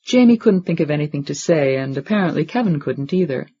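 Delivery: ordinary delivery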